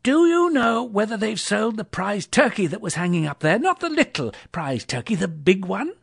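high, loud excited question; giddy Scrooge pressing for an answer; eager inquiry. A narrator's reading. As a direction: call it out eagerly, pitched high.

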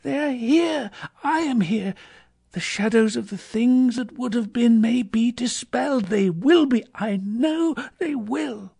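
high, elated Scrooge; overjoyed, rising in force; joyful cry. A narrator's reading. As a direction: cry it out high and insistent with relief. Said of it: "cried Scrooge, folding one of his bed curtains".

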